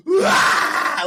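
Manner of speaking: high unvoiced wail like a crying five-year-old; mock crying yelp; imitated child's cry. Niece crying while carried uphill, mock wail. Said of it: "like that kind of crying".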